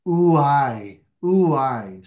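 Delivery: "ouai" said slowly as a drawn-out oo-AI wail; Greek ouai. Shown as a demonstration. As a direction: stretch "ouai" into a slow sighing oo-ai. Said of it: "it embodies sort of onomatopoeia".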